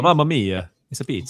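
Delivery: hammed-up pizza chef voice; jolly italian pizza chef. Joking about Italian omnibus.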